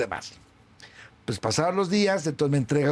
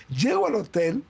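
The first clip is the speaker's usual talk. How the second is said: ordinary talk, nothing marked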